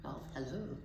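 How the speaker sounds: ordinary delivery, nothing marked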